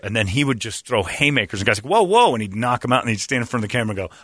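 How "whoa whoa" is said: high, loud startled whoa. Retold or imitated. Victim reacts to sudden haymakers, startled yelp. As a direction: yelp it as the punches land.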